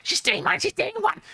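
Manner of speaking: high squeaky Cockney mice; Cockney mice squealing as they scarper. Acted. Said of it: "in Squeaky Cockney".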